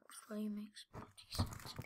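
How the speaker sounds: soft hushed whisper. Real, not acted. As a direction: whisper it to yourself. Muttering while watching.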